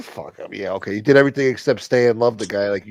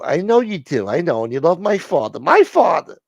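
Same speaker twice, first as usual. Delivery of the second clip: a higher, louder, put-out woman on the phone; indignant caller; 9 semitones higher, 4 dB louder than usual. Acted. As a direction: play her as theatrically offended. Same high voice in skit.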